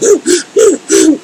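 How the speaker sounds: high, sobbing gasps for breath; acted crying gasps. Storyteller re-enacts crying in burning pain, gasping. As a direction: mimic gasping from pain and tears. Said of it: "And so I'm crying. I'm to the point of".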